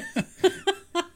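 high-pitched burst of laughter; real amused laugh. Real, not acted. Laughing over misremembered movie line.

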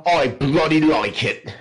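put-on slow, amused British accent; mock English accent. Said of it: "his best English accent".

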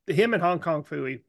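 ordinary talk

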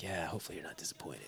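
quick, hushed aside; hushed, fast, doubtful aside; quiet fast mutter. Retold or imitated. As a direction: rattle it off under the breath. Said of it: "I'm like".

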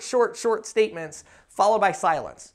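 ordinary delivery

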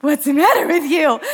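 half-incredulous question; puzzled face. Retold or imitated. Driver baffled at the screaming woman, incredulous. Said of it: "look on my face, like".

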